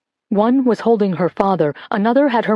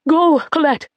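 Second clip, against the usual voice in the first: high, louder urgent cry; desperate cry; 7 semitones higher, 3 dB louder, slower than usual. A narrator's reading. Mother urges daughter out, high desperate cry. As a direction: push her out with desperate urgency. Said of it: "her mother cried again".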